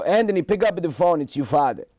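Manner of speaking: impatient Italian father on the answering machine; father demanding his son pick up, Italian accent; Italian dad accent. Retold or imitated. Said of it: "with that accent".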